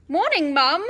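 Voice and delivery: cheeky son greeting his angry mother, jaunty; jaunty, winning. Acted. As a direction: try to sound innocent and cheerful to mum. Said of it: "a jaunty, winning voice".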